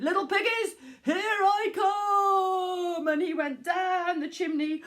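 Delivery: loud, high, very slow here I come; wolf shouting. Acted. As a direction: yell it as he drops down. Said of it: "And then he shouted".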